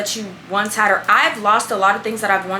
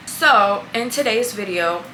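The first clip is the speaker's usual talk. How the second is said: normal talking voice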